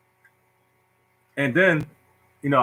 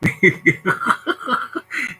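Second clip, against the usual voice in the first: high, airy string of chuckles; high laugh; 5 semitones higher than usual. Real, not acted. Storyteller laughing at his own story, high giggle.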